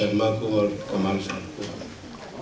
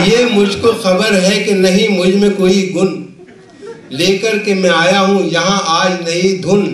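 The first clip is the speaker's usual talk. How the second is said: measured, projected Urdu verse recital; comic poet reciting with rhythmic phrasing; mushaira recitation; 7 semitones higher, 7 dB louder than usual. Acted. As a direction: declaim the couplets to a live audience, line by line.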